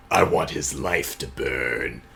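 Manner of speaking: slow, low, softened menace; mock vengeful voice. Woman wishing revenge on the producer, mock vengeful voice. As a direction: drop low and draw out the curse. Voicing her revenge fantasy.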